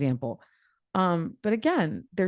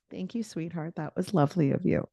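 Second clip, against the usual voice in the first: sweet and soft; 8 dB quieter than usual. Acted. Grateful reply to a partner.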